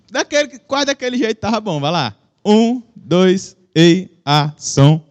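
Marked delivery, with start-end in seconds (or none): 2.5-5.0 loud, spaced-out sound check; mic test. Real, not acted. Preacher tests the monitor for the sound operator, loud mic-check call. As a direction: count out loudly and deliberately, lower in pitch.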